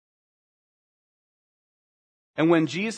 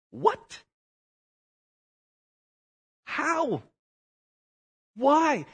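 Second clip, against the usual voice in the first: high, stunned what, how, why; rising incredulous questions; 11 semitones higher, much slower than usual. Acted. Preacher to congregation, baffled high questions. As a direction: ask it like you cannot believe it.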